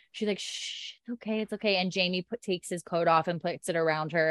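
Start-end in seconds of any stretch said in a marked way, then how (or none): 0.4-1.8 soft, gentle shushing; acted comforting murmur; soothing hush. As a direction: hush someone who's upset, softly. Comforting a sobbing girl in retelling.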